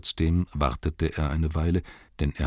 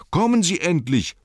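high, raised shout; impatient yell; 12 semitones higher, 5 dB louder than usual. A narrator's reading. Bilbo orders Gollum to come along, impatient yell. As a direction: yell it, out of patience. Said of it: "brüllte er".

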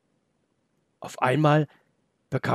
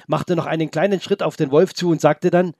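plain reading voice

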